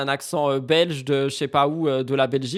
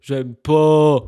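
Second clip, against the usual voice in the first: louder, stubborn j'aime pas; emphatic dislike; 10 dB louder than usual. Real, not acted. Streamer rejecting what he does on screen, loud refusal. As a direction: push the refusal out harder each time.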